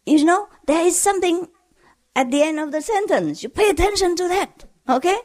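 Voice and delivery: teacher gently explaining the tune to a student; patient teacher. Retold or imitated. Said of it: "So the teacher say".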